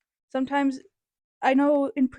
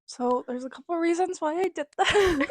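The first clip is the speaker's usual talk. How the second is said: higher, louder, laughing embarrassed start; 5 semitones higher, 5 dB louder than usual. Real, not acted. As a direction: start explaining through a laugh.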